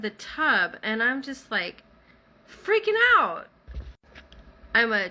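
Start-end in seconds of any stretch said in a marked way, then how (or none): 2.5-3.5 high, loud burst of retold panic; freaking out. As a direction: let it leap up into a near yell. Retelling her own panic.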